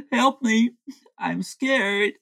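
high-pitched mock distress; feigned cry for help. [name] faking fear while secretly eating, mock cry for help. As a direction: put on a brief frightened plea. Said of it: "He is screaming help, and I'm scared".

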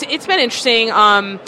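usual speaking voice

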